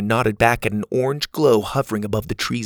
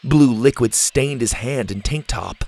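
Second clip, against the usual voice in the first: plain reading voice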